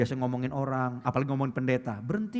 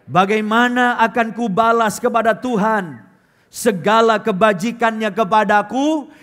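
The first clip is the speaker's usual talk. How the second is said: loud, slow, higher unison reading; congregational reading; 6 semitones higher, 10 dB louder, slower than usual. Real, not acted. Preacher leads the church reading the psalm aloud, loud and slow. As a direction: read the verse together, slow and full.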